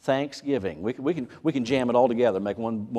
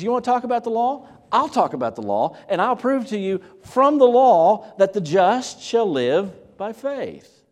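challenging; 9 semitones higher, 8 dB louder than usual. Retold or imitated. Paul challenging the law-keepers.

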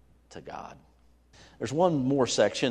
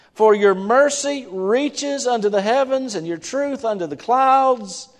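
ringing psalm reading; preaching at full force; 11 semitones higher, 12 dB louder than usual. Acted.

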